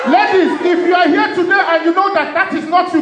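ordinary talk

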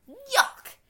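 usual speaking voice

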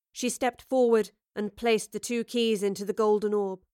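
ordinary delivery

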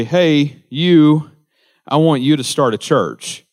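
a light take on God's call, rising on "you". Retold or imitated.

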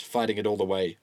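ordinary delivery, nothing marked

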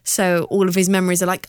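normal talking voice

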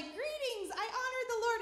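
very high, loud comic hail; mock-cheery greeting. Saul greeting Samuel despite his guilt, mock-cheery. Mocking Saul's falsely innocent greeting.

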